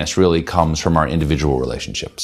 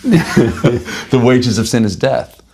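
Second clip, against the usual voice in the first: laughing; 6 semitones higher, slower than usual. Real, not acted. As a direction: laugh it out, drawing the words out. Joking after his mock regret.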